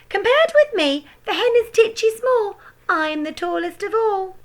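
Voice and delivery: high, loud crone voice; boastful grandma. A narrator's reading. Grandma bragging to George from the roof. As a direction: gloat from the roof.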